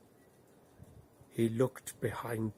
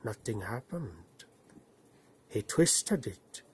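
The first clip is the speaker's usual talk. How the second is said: usual speaking voice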